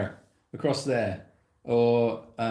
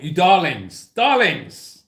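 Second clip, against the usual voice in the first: theatrical calling out; 2 semitones higher, 6 dB louder, slower than usual. Acted.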